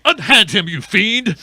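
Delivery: lightly voiced heroic command, fast; brash old-fashioned hero bravado. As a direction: toss off a quick hero line. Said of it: "hero commands villain".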